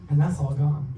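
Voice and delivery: ordinary talk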